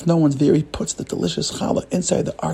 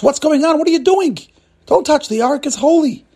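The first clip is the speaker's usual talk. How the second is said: high, loud scolding; alarmed shout; 12 semitones higher, 5 dB louder than usual. Acted. Cleaner catching Joseph at the open ark, shouting in outrage. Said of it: "And he shouts".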